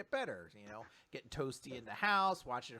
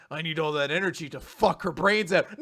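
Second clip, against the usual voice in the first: mock horny, loud declaration; lustful outburst; 7 dB louder than usual. Recapper acts the boy's horny thought, lustful outburst. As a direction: blurt it loudly, hammed-up.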